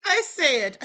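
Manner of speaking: normal talking voice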